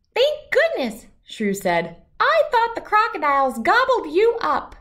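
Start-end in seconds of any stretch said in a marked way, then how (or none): 0.1-1.1 high, louder little squeak of relief; a tiny shrew glad his friend lives; tiny relieved shrew voice. Acted. Shrew finds New safe, high relieved voice. 2.2-4.7 high, louder little squeak of relief; a tiny shrew glad his friend lives; tiny relieved shrew voice. Acted. Shrew finds New safe, high relieved voice.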